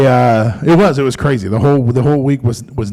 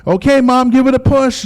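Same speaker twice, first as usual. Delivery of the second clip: much higher, gentle coaxing; 11 semitones higher than usual. Retold or imitated. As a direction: coax her like a cheerleader. Coaching in labor, high.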